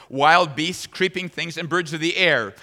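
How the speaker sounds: ordinary talk, nothing marked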